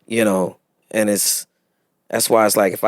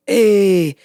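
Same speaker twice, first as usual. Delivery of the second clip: higher, lightly put-on voice of a young French fan; young French dudes; 11 semitones higher, 3 dB louder, slower than usual. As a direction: pitch it up like an excited fan abroad. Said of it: "these five young French dudes".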